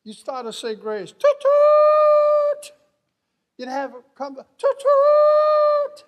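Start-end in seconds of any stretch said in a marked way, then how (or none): 1.1-2.7 very high, loud imitated train horn; piercing locomotive whistle imitation; yelled train whistle. Imitates the train interrupting grace. 4.4-6.0 very high, loud imitated train horn; piercing locomotive whistle imitation; yelled train whistle.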